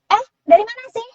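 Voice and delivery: high put-on cempreng voice; cempreng, shrill. Shown as a demonstration. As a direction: pipe it up in a shrill squeal. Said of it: "suara kalau orang bilang tuh cempreng".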